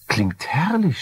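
high, gushing agreement; eager wife. Acted. Wife agreeing to the new house, high and gushing. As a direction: sing it up high, thrilled. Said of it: "stimmte sie zu".

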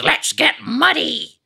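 very high, slow cartoon voice saying muddy; same cartoon squeak, muddy in place of mucky; American version of the catchphrase, high and slow; Muck, American version. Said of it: "But in America, it was".

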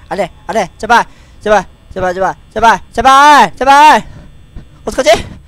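frantic high desperate begging; panicked pleading. Real, not acted. Begging during final seconds.

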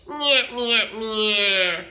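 loud cackling witch nyah; wicked witch. Shown as a demonstration. Teacher demos twang with a wicked witch voice. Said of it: "like a wicked witch".

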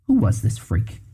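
ordinary delivery, nothing marked

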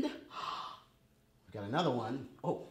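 normal talking voice

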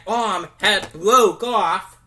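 ordinary talk, nothing marked

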